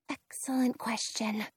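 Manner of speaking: unvoiced whisper. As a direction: whisper it under your breath. Hiding from a witch.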